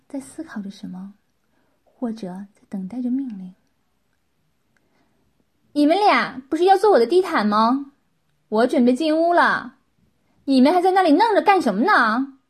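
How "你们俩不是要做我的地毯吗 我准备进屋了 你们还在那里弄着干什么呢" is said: loud, higher-pitched reprimand; stern, sharp scolding. A narrator's reading. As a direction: demand it harshly, loud and impatient. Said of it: "秦厉声说道".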